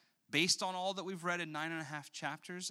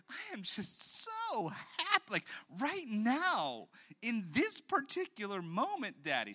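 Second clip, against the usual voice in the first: put-on slow, much higher, gushing little girl; toddler voice; 8 semitones higher, slower than usual. A lightly voiced toddler telling her daddy she is happy. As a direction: stretch out the joy like a small child. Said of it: "She didn't say that part".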